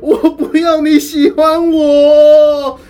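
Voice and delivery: a crying schoolgirl, hammed up and slow; mock sobbing wail. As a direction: wail it slowly like a girl in tears, half laughing. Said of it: "她就越哭越大声".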